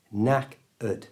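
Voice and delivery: knack-ud said slowly in two parts, silent k, ending ud; slow syllables. Shown as a demonstration. Teacher spells out knackered slowly as knack-ud. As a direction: break it down, knack then ud. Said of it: "so it's knack-ud".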